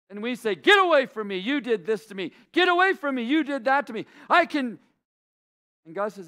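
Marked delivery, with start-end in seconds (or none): 0.5-4.8 hammed-up loud refusal, much higher; mock angry rejection. We spurning Jesus, a mock angry shout. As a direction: push him away with mock outrage.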